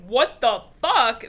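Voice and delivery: loud, slow shocked outburst. Retold or imitated. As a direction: stretch it out in disbelief. John shocked by strong girl.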